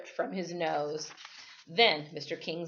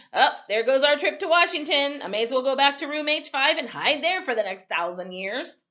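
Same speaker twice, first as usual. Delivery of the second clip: groaning; 7 semitones higher, 6 dB louder than usual. Acted. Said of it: "I groaned and sighed".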